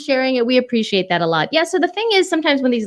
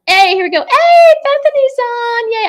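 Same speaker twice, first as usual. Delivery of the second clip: high, loud hello to a viewer; excited greeting; 7 dB louder than usual. Real, not acted. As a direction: greet Bethany like a friend.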